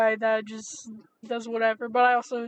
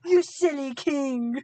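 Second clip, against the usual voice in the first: slow, hammed-up British accent; mock French taunt, slow; outrageous accent; 2 semitones higher, slower than usual. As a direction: sneer it like a Monty Python Frenchman. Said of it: "this outrageous accent".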